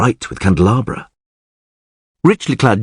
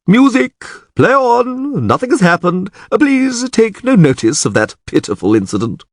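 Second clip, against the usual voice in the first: flustered chamberlain; 12 semitones higher than usual. A narrator's reading. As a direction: hurry the music back to cover the scandal. Chamberlain calming the ballroom.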